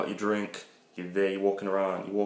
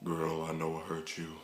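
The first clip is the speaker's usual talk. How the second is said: put-on [name] voice; 3 semitones lower, 7 dB quieter than usual. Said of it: "kind of Michael voice".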